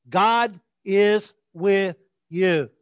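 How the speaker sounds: each word on its own; slow emphatic declaration. Real, not acted. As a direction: declare it slowly, one word at a time. Driving home the point.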